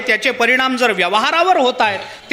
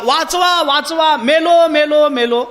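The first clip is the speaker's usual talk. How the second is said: shouting for help; 3 semitones higher, slower than usual. Retold or imitated. Dreaming hunter yelling for help at the tiger, hammed-up panic. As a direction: cry out for help as if the tiger is on you. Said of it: "मोठमोठ्यानं ओरडला".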